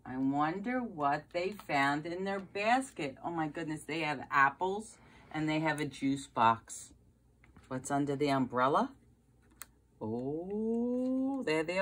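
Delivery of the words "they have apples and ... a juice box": ordinary delivery, nothing marked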